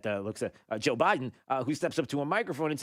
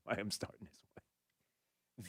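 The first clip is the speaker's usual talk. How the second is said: whisper; 5 semitones lower, 13 dB quieter, slower than usual. Real, not acted. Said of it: "I have no idea".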